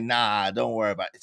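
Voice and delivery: low, soft brush-off; waving off her worry, unbothered; cool and calm. Retold or imitated. [name] calmly waving off a panicking [name], low and soft. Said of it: "and he was like".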